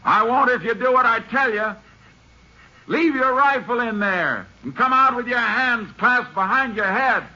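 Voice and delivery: high, carrying calls across the open shelf; calling out. Acted. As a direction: shout terms of surrender to the hidden gunman. Bargaining shouted across to the mine.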